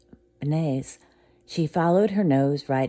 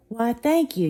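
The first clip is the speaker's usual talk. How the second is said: higher, louder mother; gracious thanks; 9 semitones higher, 4 dB louder than usual. Acted. Eudora thanking Tiana, higher and gracious.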